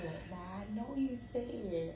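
lost voice whisper. Retold or imitated. Retelling voice loss.

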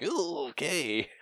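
high, drawn-out wary okay; taken-aback reaction. Retold or imitated. Viewer reacting to a character's sudden rage, taken aback. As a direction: back off slowly from someone's outburst.

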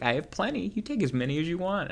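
lightly high, sweet grandma voice; a hint of a kindly old volunteer; sweet old poll worker. Elderly volunteer pressing extra stickers on voter, high sweet voice. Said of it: "'cause, like".

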